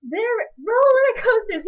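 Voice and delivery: put-on high, slow, near-shouting kid protest; a mom imitating her son insisting planes don't exist; insistent child. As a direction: drag it out like a child who won't be told otherwise. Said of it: "he's like".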